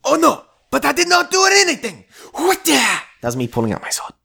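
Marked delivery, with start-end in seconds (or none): 0.0-2.0 high, loud hammed-up protest; mock panicked samurai-film victim. Mock samurai duel skit. 2.3-3.0 high, sharp vocal swish; high-pitched mock blade sound; imitated sword being drawn. Sword noise in duel skit.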